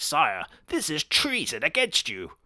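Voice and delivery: slow, lightly voiced stuffy advisor; pompous advisor. Advisor warning the king, slow and stuffy. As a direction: warn the king, stiff and slow. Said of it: "the advisor dude is like".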